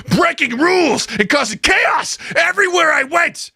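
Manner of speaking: ranting pundit at a high-pitched shouted peak; Alex Jones impression. Co-host confesses a cartoon-induced rampage as a shouting, high-pitched pundit. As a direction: cry out each phrase in hammed-up outrage, very high. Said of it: "read it in Alex Jones' voice".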